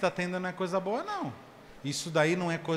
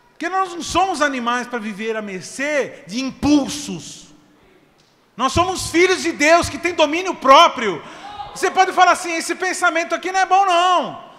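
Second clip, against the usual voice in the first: loud, high, building preacher's shout; preaching at full force; 10 semitones higher, 14 dB louder than usual. Acted. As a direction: rouse the congregation, climbing to a shout.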